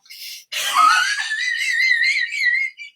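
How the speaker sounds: very high squealing burst of laughter; spluttering shriek into helpless pretend laughter; hammed-up hysterical squeal; put-on uncontrollable laugh. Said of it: "it's giggle juice and I can't stop".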